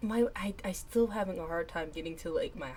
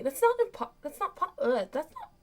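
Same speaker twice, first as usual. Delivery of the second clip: stammering shock; 10 semitones higher, 8 dB louder than usual. Acted. Stammering character, high pitch.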